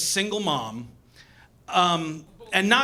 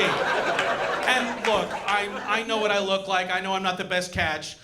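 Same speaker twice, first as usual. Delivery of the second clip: ordinary delivery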